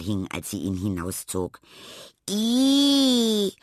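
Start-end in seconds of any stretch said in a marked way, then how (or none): 2.3-3.5 high, loud cry of disgust; a squealing yuck from the whole class. Acted. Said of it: "ekelte sich die Klasse im Chor".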